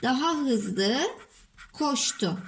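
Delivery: drawn-out phrase, pause, last word; dictating while writing. Shown as a demonstration. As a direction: dictate in pieces as you write. Oluşturduk cümleyi.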